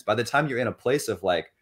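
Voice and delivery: normal talking voice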